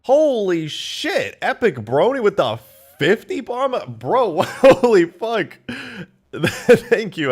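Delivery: high, loud shout of amazed thanks; overwhelmed excitement. Real, not acted. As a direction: shout in disbelief at a huge gift. Reacts to a big gift.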